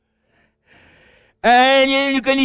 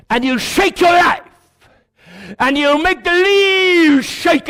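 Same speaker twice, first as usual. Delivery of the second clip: full-force preaching; 6 semitones higher than usual. Acted. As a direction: preach it big and slow.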